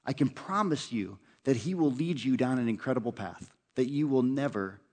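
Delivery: ordinary talk, nothing marked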